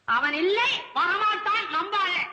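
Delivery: high, insistent wail; despairing outburst. Acted. Poet alone decides his helper won't come, despairing outburst. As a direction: warn yourself off him, pitched high.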